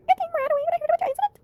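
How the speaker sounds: high, bright-toned put-on voice of a friend; Peyton voice. Friend rushes to the police station, high Peyton voice. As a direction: pitch way up to play Peyton fussing over her friend. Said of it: "I like to do her voice".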